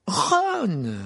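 a sharp, high scolding call; reproachful. A narrator's reading. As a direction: scold him for the broken glass. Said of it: "sur un ton de reproche".

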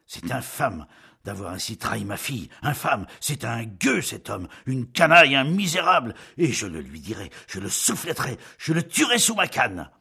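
angry tirade building to a shout; exasperated. A narrator's reading. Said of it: "s'animant, toujours exaspéré".

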